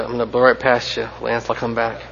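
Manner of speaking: normal talking voice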